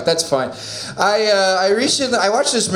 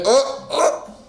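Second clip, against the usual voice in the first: clueless shopper voice; 13 semitones higher, slower than usual. Acted.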